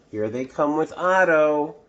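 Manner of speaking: ordinary delivery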